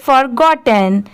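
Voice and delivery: forgotten quickly as one joined word; brisk, fluent reading of forgotten; said at speed. Shown as a demonstration. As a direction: run for-got-ten together without gaps. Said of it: "अब इसे speed में पढ़ेंगे".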